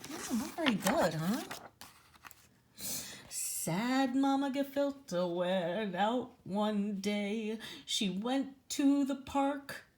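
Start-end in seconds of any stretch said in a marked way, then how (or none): 3.3-9.9 slow, sad sing-song verse; slow storybook reading. A narrator's reading. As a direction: read it slowly, like a sad bedtime rhyme. Reads rhyming book aloud.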